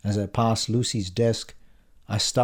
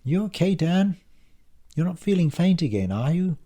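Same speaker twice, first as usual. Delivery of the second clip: higher-pitched, questioning coworker voice; raised pitch, solicitous questions; concerned female colleague; 8 semitones higher than usual. A narrator's reading. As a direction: check on an unwell workmate. Sonia's acted concern for narrator.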